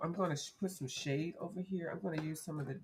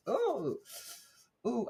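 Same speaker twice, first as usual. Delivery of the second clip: ordinary delivery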